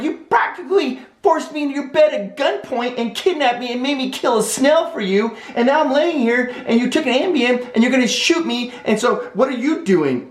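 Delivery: high-pitched, hammed-up outrage; indignant protest. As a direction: rant back in a squeaky incredulous voice. Indignant reply to her accusation.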